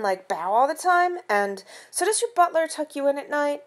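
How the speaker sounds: high, teasing classmate voice; mocking kids. Classmates taunting Carter about his butler, high mocking voice. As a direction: sneer out the questions like a taunting kid. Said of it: "It was like".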